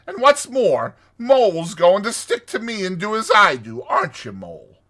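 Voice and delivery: loud, emphatic, stubborn insistence; dogged. A narrator's reading. Rat standing by his old river, dogged. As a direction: hammer out each point defiantly. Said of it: "said the rat doggedly".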